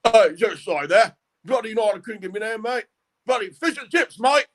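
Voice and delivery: high, bloody-this British accent; British bloke accent. Shown as a demonstration. Impressionist showing the host his high British street accent. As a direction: pitch up a cheeky British "mate" voice. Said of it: "different kinds of United Kingdom accents".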